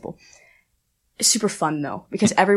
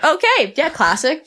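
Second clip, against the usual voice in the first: mock casual; 8 semitones higher, slower than usual. Rey Mysterio walks in.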